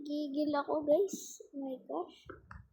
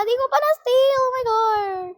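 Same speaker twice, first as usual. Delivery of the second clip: loud, higher shout of frustration; frustrated yell; 9 semitones higher, 9 dB louder than usual. Real, not acted. Gamer yelling at a missed steal, loud and high. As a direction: yell it out as the steal slips away. Steal attempt fails mid-game.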